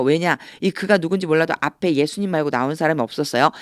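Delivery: ordinary delivery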